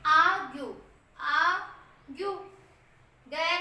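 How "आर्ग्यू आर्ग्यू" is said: आर्ग्यू said loud, the second take slow; teacher models आर्ग्यू; model word argue. Shown as a demonstration. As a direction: call out आर्ग्यू loudly, then stretch it. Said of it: "example हम देखेंगे".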